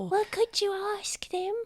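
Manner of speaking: very high hushed voice; hopeful little child. Acted. Child asking the adventurer to check, high and hushed. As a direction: ask softly, high and small. Child at window secretly pleading.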